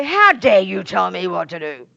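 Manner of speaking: loud, indignant shout in a put-on voice; mock-furious yell at a caring doctor; outraged shout. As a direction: blow up at the doctor. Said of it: "You don't say".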